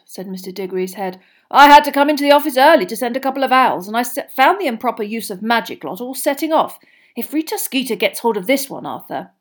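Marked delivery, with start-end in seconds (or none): 1.5-9.3 Mr Diggory. A narrator's reading. Diggory voiced.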